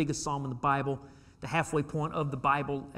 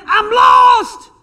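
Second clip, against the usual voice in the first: shouted, much higher plea; crying out to God; 17 dB louder, much slower than usual. Retold or imitated. Psalmist crying to God: much higher, louder desperate shout. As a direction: cry out desperately to be found. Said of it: "He's saying".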